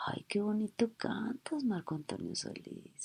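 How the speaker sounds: swooning whisper. Acted. Fan whispering adoringly to the singer. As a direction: sigh it at the singer. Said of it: "es para decirle".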